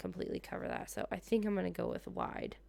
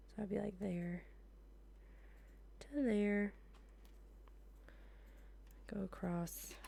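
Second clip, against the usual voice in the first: whispered to self; 9 dB quieter, slower than usual. Real, not acted. Muttering to herself while crafting.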